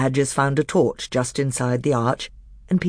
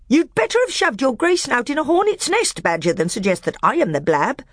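high, loud, heaping insults; scornful dwarf; 12 semitones higher, 4 dB louder than usual. A narrator's reading. Nickabrick mocking the magician in open anger. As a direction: jab each name at him.

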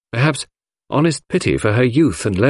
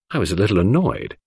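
usual speaking voice

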